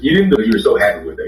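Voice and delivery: ordinary talk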